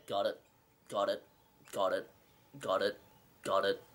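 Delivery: low, softened, repeated got-its; deadpan retold answer. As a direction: tick them off one by one, low and unimpressed. Retold repeated answer while browsing games.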